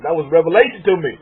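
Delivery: usual speaking voice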